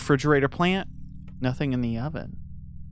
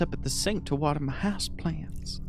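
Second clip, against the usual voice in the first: whisper; 6 dB quieter than usual. Acted. Watering plants aside.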